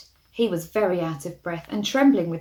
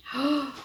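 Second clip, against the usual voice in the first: gasp; 6 semitones higher than usual. Acted. Reading to child, dramatic gasp.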